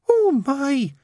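very high nun's Oh, my; flustered dismay. A narrator's reading. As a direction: exclaim up high, caught out. Nun flustered, hand to mouth.

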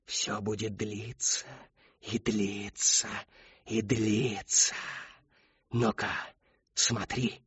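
soft and slow, each phrase dragged out; a ghost toying with a boy; eerie taunting. A narrator's reading. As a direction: taunt him quietly, savouring it. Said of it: "ухмыльнулась Шептунья".

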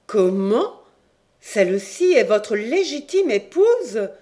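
a startled outburst, voice jumping up; astonished cry. A narrator's reading. Queen learning the captain's wife is her daughter, astonished cry. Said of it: "s'écria la reine".

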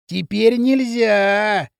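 very drawn-out tipsy singsong; sugary drunk warning. A narrator's reading. Drunk Пакля cautions Кирилл, slow and sweet. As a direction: stretch it out like a cooing drunkard. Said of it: "ласково предостерёг Пакля".

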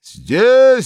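high, loud sudden bellow; loud booming shout; thundering shout. A narrator's reading. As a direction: boom it right by the door. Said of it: "загремело возле уборной".

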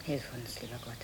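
soft, low murmured prayer to God; hushed prayer. Acted. Mother alone praying for her daughters, softly hushed. Mother prays as girls drink.

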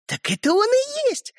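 put-on very high, gleeful girl; joyful. A narrator's reading. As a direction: announce it with high-pitched delight. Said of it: "радостно заявила Ариша". (радостно)

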